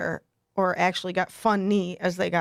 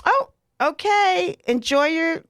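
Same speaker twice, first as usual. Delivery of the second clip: put-on carefree old lady sending them off, pitched up; breezy older lady; 6 semitones higher, 4 dB louder than usual. Older lady leaving strangers to enjoy mass, imitated breezy farewell. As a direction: imitate her higher, louder farewell. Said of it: "she's just like".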